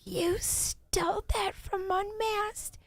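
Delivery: very high, slow squeaky mock voice; mock Southern Baptist lady. Streamer mocking a chat troll, very high squeaky voice. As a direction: squeal it like an outraged church lady. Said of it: "this chick came in and she was like".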